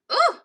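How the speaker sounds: short, high-pitched "ooh!"; sudden little yelp of ooh; startled ooh. Real, not acted. Cook reacting mid-task, startled ooh. Startled while handling the food.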